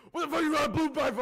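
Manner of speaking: high, loud, fast mocking shout; mock angry yell. Guy swearing from his car at her, loud fast yell. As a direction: yell it at the kid on the blue bike, quick and loud. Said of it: "be like".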